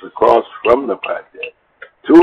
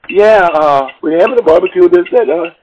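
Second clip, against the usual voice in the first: imitating her on the phone, pitched much higher; woman's voice; 10 semitones higher, 5 dB louder than usual. Woman calls the next day asking him to cook, imitated high. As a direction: put on her sweet-talking phone voice.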